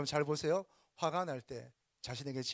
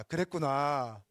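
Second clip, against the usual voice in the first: slow, steady "그랬구나" with a falling end; gentle understanding; calm acknowledgment; 6 dB louder, much slower than usual. Shown as a demonstration. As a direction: say "그랬구나" calmly, letting it fall. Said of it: "자".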